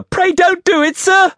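high, loud frightened squeal; terrified pleading. A narrator's reading. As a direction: beg desperately in a child's high voice. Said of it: "I pleaded in terror".

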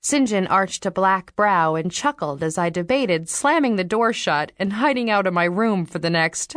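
ordinary delivery, nothing marked

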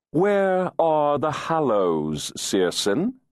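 high, slow, taunting fairy; sly fairy voice. Acted. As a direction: drawl it out like a teasing fairy. Adam questions Searson.

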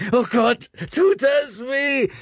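high, slow, strained cry of pain. A narrator's reading. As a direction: wail it out in agony, stretched slow. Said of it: "Weitere Schreie".

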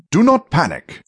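high, firm interruption; urgent command. A narrator's reading. Arcturus cutting off the thief's panic, urgent command. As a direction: cut in to steady them.

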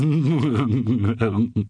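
strange drawn-out creepy chuckle; odd long laugh. A narrator's reading. Odd man laughing at the cartoonist, uncomfortably long. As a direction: laugh too long until it's uncomfortable. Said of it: "the odd laugh that followed went on far longer".

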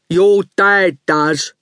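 slow, daft lout; strong daft voice. A narrator's reading. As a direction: speak slowly and strongly, like a big dim thug. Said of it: "He spoke in a strong, daft voice".

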